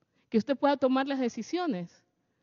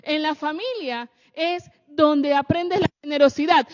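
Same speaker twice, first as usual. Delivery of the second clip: normal talking voice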